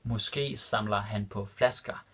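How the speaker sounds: Måske samler han på flasker said slowly and distinctly; careful slow repeat for students; slow and clear. Shown as a demonstration. Teacher repeating the Danish example for learners, slow and clear. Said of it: "repeated slower for learners".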